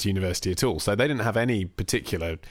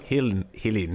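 put-on South African accent. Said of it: "the South African accent".

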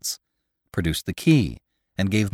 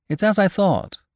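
amused; 10 semitones higher than usual. Acted. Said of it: "with an amused expression".

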